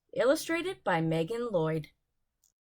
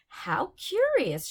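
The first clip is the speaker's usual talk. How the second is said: curious; 6 semitones higher, slower than usual. Acted. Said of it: "she said".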